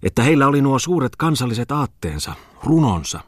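high, fervent philosophising. A narrator's reading. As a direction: expound grandly on lofty ideals. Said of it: "filosofoi hän kämmeniään levitellen".

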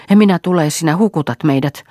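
high, loud panicked cry; terrified shout. Acted. Marja refusing Juha's boat, panicked loud shout. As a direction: scream it out in panic. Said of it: "hän huusi".

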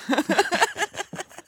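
squeaky high giggle; high laugh. Real, not acted. Laughing at punchline.

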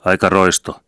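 short, loud, indignant cry; angry shout. A narrator's reading. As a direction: hurl the insult angrily. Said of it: "huusivat hämäläiset suuttuneina". (suuttuneena huudahtaen)

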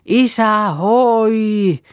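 loud high holler with a drawn-out "hoi"; calling out harder. Acted. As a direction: put full force into a long-distance call. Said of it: "Huudetaan vaan ja vieläkin kovemmasti".

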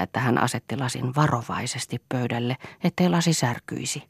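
plain reading voice

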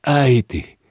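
sudden high, loud cry of Äiti; a boy's high shout of alarm to his mother; outcry. A narrator's reading. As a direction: call out to stop her. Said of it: "huudahti Iikka niin".

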